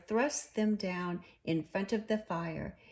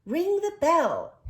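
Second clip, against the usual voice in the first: high, loud urging; loud urgent order; urgent command; 9 semitones higher, 9 dB louder than usual. A narrator's reading. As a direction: bark it out impatiently. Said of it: "interrupted the rat".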